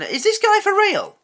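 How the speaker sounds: high, loud incredulous outcry; can't believe what he's hearing; disbelieving outburst. Real, not acted. Reacting in disbelief to video.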